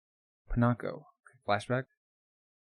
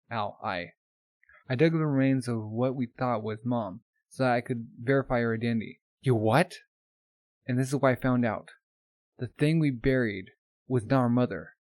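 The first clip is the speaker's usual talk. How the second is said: ordinary delivery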